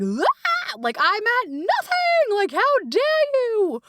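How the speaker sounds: very high, slow, strained whine; high, drawn-out outraged whine; depleted parent. Retold or imitated. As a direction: play a parent at the end of their rope. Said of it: "we're not giving from, like".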